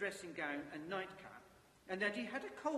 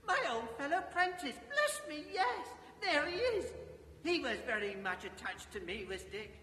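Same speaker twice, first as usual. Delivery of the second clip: much higher, louder fond delight; 8 semitones higher, 5 dB louder than usual. Acted. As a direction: gush with fond joy. Scrooge fondly remembers friend.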